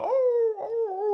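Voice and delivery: high, sung dog howl. Pretend. Imagining howls behind the song, high musical howl. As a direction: howl like a backing-vocal hound. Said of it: "but howls".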